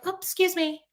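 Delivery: high, dainty mock apology; fake politeness. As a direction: imitate an insincerely polite excuse-me. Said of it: "I usually am not fake about it".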